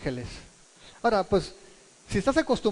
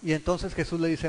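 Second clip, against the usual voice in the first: ordinary talk, nothing marked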